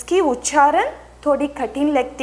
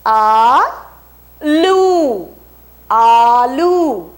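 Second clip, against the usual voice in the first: loud, slow classroom model of आलू; drawn-out model word; 7 dB louder, much slower than usual. Shown as a demonstration. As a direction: stretch आलू very slowly and louder. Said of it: "आप बोलिए".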